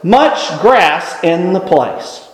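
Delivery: "much" drawn out and weighted, the rest slow; slowly repeating "much grass in the place", stressing "much". Shown as a demonstration. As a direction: lean on "much" to make them notice. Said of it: "There was".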